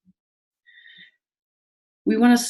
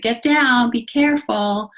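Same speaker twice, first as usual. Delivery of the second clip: put-on sharp raised "Get down" at a climbing child; mock sharp command; 6 semitones higher, 4 dB louder, slower than usual. Shown as a demonstration. Teacher calling a climbing child down, mock sharp command. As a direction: snap it like a hurried teacher.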